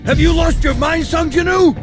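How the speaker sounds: indignant, high-pitched yell; incredulous outburst. Acted. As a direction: challenge him in disbelief. Incredulous at strange order.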